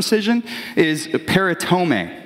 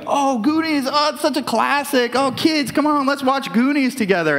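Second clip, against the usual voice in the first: put-on higher-pitched gushing parent calling the kids over; excited parent; 8 semitones higher than usual. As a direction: gush about an old favorite film, pitched up. Fond parent inviting kids to film.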